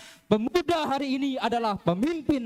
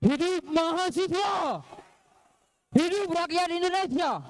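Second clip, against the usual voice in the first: high, slow rallying shout; rally speech; 7 semitones higher, slower than usual. Real, not acted. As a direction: chant it to the crowd. Rally chant opening the oration.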